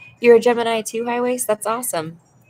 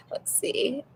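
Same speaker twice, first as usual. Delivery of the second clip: whisper; 4 semitones higher, 6 dB quieter than usual. Real, not acted. Whispered aside.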